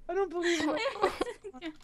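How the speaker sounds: high, slow words through laughter; laughing disbelief. Real, not acted.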